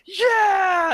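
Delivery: high, loud drawn-out cheer; mock roar of excitement; imitated crowd cheer. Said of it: "everybody's like".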